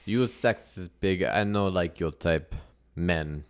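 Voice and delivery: put-on accented strongwoman's contempt; halting, heavy-accented disdain; scornful strong woman. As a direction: play a blunt foreign strongwoman.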